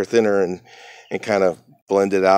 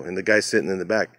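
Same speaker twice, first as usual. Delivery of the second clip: ordinary talk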